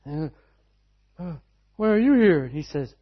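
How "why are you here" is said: loud, demanding question; a doctor loudly asking why they came; doctor's loud challenge. Retold or imitated. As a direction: bark it at the family. Doctor confronts the praying family.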